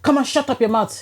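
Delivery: a hint of a stern auntie; retold scolding auntie. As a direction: imitate a midwife telling off a patient. Retells Nigerian midwife scolding her.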